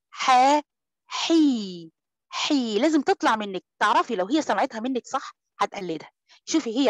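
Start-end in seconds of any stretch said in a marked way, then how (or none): 0.1-2.8 ح and حي breathed from the throat, no k; breathy throat ha in حي, never a k; clear haa. Shown as a demonstration. Teacher showing how حي should sound. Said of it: "لما تديها الصوت".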